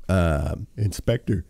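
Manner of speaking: talking through a yawn; words stretched by a yawn. Real, not acted. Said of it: "Pardon the yawning".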